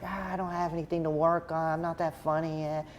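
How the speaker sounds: high comedian impression, bashfully declining; Adam Sandler. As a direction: badly imitate a modest star. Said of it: "my terrible Adam Sandler impression".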